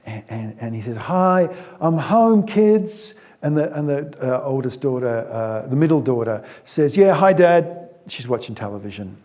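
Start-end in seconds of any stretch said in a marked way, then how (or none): 0.9-3.2 higher and louder, beaming hello; cheery dad greeting. Acted. 6.9-7.9 put-on louder and higher, offhand teen reply; bored teen. Daughter answers without looking up.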